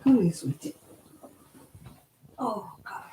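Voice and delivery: ordinary delivery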